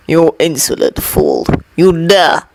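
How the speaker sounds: 低沉愤怒的反派嗓音，咬字冷硬; deep, menacing villain threat; angry villain. A narrator's reading. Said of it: "voice angry".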